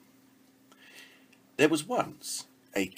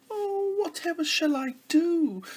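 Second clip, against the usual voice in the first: high, loud mock wail; 7 dB louder than usual. Princess losing her ball in the pond, wailing. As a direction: wail like a distraught little princess. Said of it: "wailed the girl".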